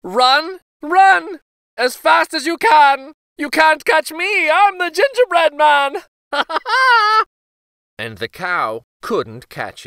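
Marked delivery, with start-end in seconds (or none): 0.0-6.0 high, loud, gleeful taunt; sing-song jeer at his chasers; gingerbread boy taunting shout. A narrator's reading. Runaway cookie shouting back at old couple, high gleeful taunt. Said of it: "looked over his shoulder and cried". 6.3-7.2 high loud cackling laugh; gleeful cackle. A narrator's reading. Gingerbread boy laughing at chasers, high loud cackle.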